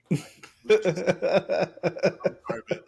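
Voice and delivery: slow laughing apology. Real, not acted. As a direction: laugh through it, drawn out. Laughs at bad trailer.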